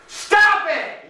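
high, sharp mock yell. Psychologist yelling at his anxious patient, high mock shout. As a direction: snap it out at the worried patient. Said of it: "he just keeps yelling".

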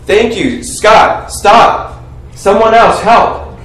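usual speaking voice

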